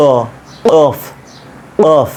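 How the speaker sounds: "অফ" built up from a back-tongue stop; showing how the qaf forms; qaf drill. Shown as a demonstration. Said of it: "তাহলে কি হবে?".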